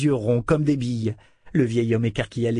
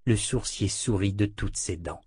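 ordinary delivery, nothing marked

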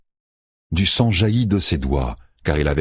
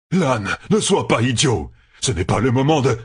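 higher-pitched angry protest; indignant crying out; 7 semitones higher than usual. A narrator's reading. General tells commander not to be an idiot, indignantly. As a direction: protest heatedly until cut off. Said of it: "cria Agelmar".

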